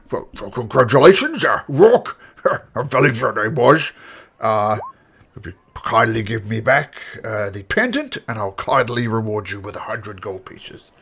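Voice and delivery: lightly voiced festival official. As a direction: congratulate them politely as the organiser. Official voice rewarding the champion.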